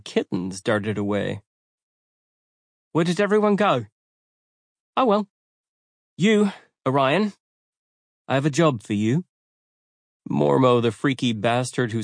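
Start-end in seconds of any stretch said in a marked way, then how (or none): 2.9-9.2 high, lilting and sing-song; breezy, airy, higher voice; melodic voice. A narrator's reading. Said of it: "a melodic voice accompanied the arrival".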